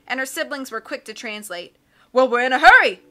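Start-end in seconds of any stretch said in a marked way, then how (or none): 2.1-3.0 a jolly captain who bellows everything; blustery sea captain. Acted. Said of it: "the captain replied".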